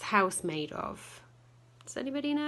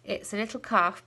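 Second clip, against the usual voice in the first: usual speaking voice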